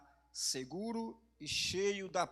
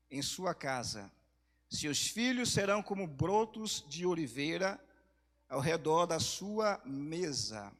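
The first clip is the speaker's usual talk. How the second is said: solemn scripture reading; 3 semitones higher, 8 dB louder than usual. Real, not acted. Pastor reading a psalm to the congregation, loud and deliberate. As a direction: proclaim the verses to the whole church, dropping at each close.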